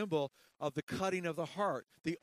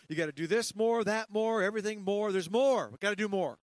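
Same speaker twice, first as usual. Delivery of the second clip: louder, higher preacher's hammering; preaching; 4 semitones higher, 6 dB louder than usual. Acted. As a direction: pound the demands at the congregation. Preaching at full force.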